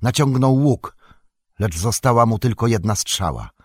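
plain reading voice

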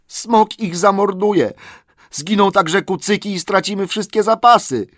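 high, loud anguished wail; groaning. A narrator's reading. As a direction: moan it out in despair. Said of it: "jęknęli inni".

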